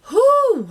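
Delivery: high loud exhale of relief; breathless whew. Real, not acted. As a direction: blow out a big high whew. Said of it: "I'm out of breath".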